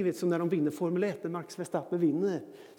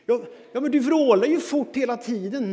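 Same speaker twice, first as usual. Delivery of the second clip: higher, louder exasperated dad; loud protest; 6 semitones higher, 10 dB louder than usual. Retold or imitated. Dad argues back at his son, loud and exasperated. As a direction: push back loudly, baffled.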